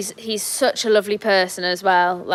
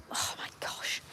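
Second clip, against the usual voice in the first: breathless whispered sigh of disbelief; exasperated whisper; 14 dB quieter than usual. Real, not acted. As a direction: whisper it under your breath. Lamb escapes the pen again.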